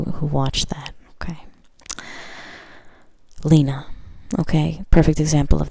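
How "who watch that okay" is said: a conspiratorial whisper; whispered aside. Real, not acted. Reader whispering to fans of the movie. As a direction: whisper it to the insiders.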